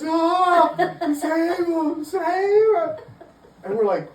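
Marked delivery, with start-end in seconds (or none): 0.0-3.0 high, wailing, hammed-up cry for help; mock frantic plea. Storyteller mimicking a man begging help for his dog, mock frantic wail. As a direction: plead in exaggerated panic.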